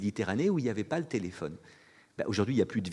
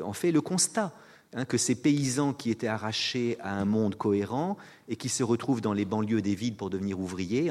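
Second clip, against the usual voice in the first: ordinary delivery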